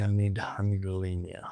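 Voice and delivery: normal talking voice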